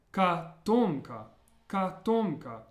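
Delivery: "katomka" with the middle syllable punched, ka-TOM-ka; stress on second O. Shown as a demonstration. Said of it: "stress of the word is on the second O".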